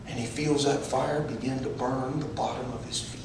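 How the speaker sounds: very soft, low, near-whispered narration; hushed suspense storytelling. Acted. Preacher describing distant screams in the pit, hushed. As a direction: drop to a hush as if hearing distant screams.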